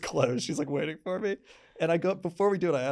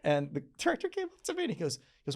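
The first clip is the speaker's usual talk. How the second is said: ordinary talk, nothing marked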